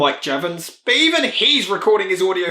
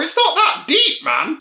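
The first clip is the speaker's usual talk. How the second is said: mock retort; 12 semitones higher, slower than usual. Mocking reply to Linkara.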